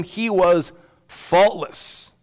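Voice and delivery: slow, weighty stress on was; he was faultless, slowed and stressed; slow emphasis. Acted. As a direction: lean hard on was, draw it out. Preacher stresses key claim.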